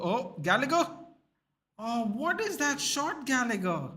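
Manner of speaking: high-pitched, drawn-out match reaction; excited outcry. Real, not acted. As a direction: exclaim in disbelief at the shot. Live reaction to a shot.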